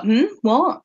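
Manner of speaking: imitating someone playing dumb; feigned not hearing. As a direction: pretend you missed what was said. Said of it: "pretends he hasn't heard".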